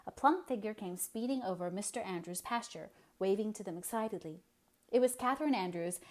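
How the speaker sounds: usual speaking voice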